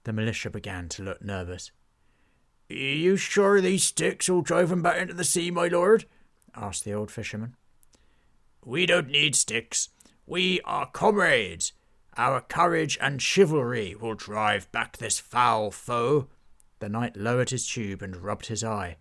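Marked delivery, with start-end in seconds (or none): 2.7-6.1 put-on higher, louder anxious old fisherman; a nervous villager pleading with his lord. Old fisherman worried about the pirates, higher and louder. 8.6-16.3 slow, loud heroic declaration; rally the troops with pompous courage; rousing knight. Acted. Knight rallying his militia, slow and loud.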